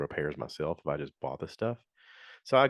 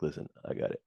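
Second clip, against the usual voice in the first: hushed aside; 2 semitones lower, 7 dB quieter, faster than usual. Retold or imitated. Gary quietly reassures the speaker.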